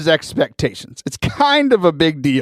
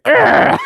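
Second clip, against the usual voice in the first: high, loud yelp; kid's cut-off yell; 5 dB louder than usual. Retold or imitated. Kid yells as the headset clicks off, high yelp. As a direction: imitate a small boy's loud squawk. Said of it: "And he's like".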